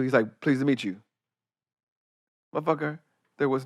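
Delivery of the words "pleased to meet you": low, softer greeting; deep voice. Retold or imitated. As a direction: drop it down like a deep-voiced star. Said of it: "His voice is deep?".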